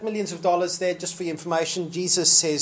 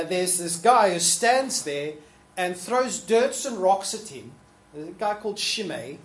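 ordinary delivery

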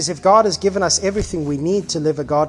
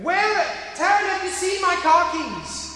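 much higher shout for the keys; frantic shout; 13 semitones higher than usual. Retold or imitated. Husband shouts to his wife while hunting for car keys, much higher. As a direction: yell through the house searching. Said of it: "you're going".

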